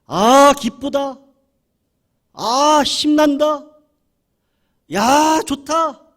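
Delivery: loud, slow self-encouragement; rousing himself awake before dawn prayer; forced cheer. Real, not acted. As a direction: pump yourself up loudly, slowly. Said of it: "제가 몸부림치는 게".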